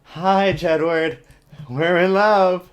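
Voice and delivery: high, loud, slow dead-eyed lovesick voice; hammed-up dreamy monotone; mock Bella. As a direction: parody a flat lovestruck girl. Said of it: "you just walk around like".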